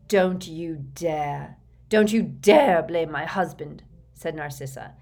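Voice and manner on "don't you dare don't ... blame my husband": slow warning, building louder; menacing, drawn-out first words, then forceful; low and deadly. A narrator's reading. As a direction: threaten in a controlled, deadly tone. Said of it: "in a low and deadly voice".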